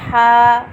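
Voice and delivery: "हा" loud, held one alif long; model letter. Shown as a demonstration. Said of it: "एक अलिफ के बराबर लंबा किया।". ("हा" एक अलिफ के बराबर)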